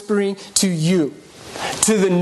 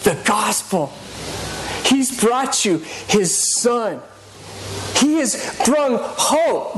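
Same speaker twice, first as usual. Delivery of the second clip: slow emphatic preaching; 4 semitones higher, slower than usual. Acted. Preaching climax.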